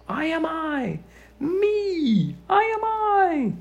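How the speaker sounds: high, loud triumphant shout, slowing at the end. A narrator's reading. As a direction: shout it proudly at the sky. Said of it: "Shout loud at the top of your voice".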